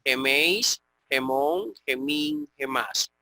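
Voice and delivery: each word starting on a rasped J, as xemeis, xemon, xemin, xemas; J sound on Geméis, gemón, gemín, gemás. Shown as a demonstration. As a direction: breathe a harsh J into the start of every form. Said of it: "sonido de J".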